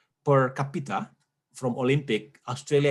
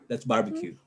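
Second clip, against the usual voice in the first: ordinary talk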